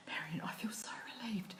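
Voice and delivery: soft whispered relief; relieved whisper. Retold or imitated. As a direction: breathe it out, relieved. Retold relieved girl.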